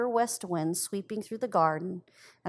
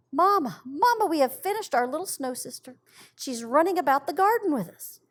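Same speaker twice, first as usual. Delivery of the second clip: higher, louder, rejoicing call to mother; excited children calling; 6 semitones higher, 4 dB louder than usual. A narrator's reading. Children calling their mother to the window, high and excited. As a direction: call Mamma through the window, thrilled. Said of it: "they both cried out to her with one voice".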